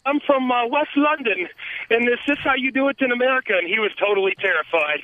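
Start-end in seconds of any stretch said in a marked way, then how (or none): 0.1-3.5 put-on self-confessed clumsy English accent, pitched higher and quick; a half-hearted stab at an English accent, quick and raised; bad English accent. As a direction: fumble through a mock English accent. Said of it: "I can't do an English accent".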